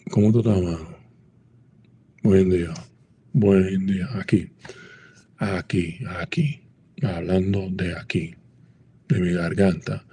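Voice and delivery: Dominican street greeting, voiced from the throat. Shown as a demonstration. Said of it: "popular to talk from this part of your throat".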